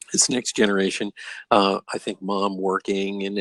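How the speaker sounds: ordinary delivery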